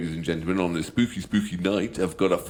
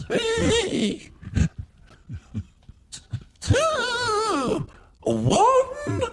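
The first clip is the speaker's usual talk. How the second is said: very high drawn-out witch count; much slower than usual. Acted. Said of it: "I'm going to do it in a witch voice".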